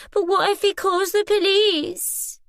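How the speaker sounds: high, slow mocking whine; whiny mimicry. Boy mocking his friend's fearful words in a whiny voice. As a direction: mimic her worried whine to belittle her. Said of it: "He put on a whiny voice".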